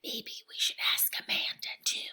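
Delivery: soft library whisper. A narrator's reading. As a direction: hush it so Amanda won't hear. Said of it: "and whispered".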